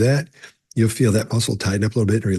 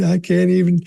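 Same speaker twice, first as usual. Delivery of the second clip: higher, whiny mock sob; imitating a fake cry; 9 semitones higher than usual. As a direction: mimic a suspect faking a breakdown. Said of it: "this fake cry".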